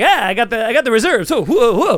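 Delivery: Jimmy Fallon impression. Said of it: "and he's like".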